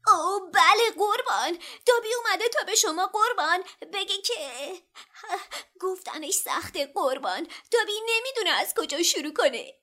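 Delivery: high, squeaky and breathless with excitement; an overeager house-elf bursting to speak; high excited elf squeak, loud; eager elf voice. A narrator's reading. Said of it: "دابی با شور و شوق گفت".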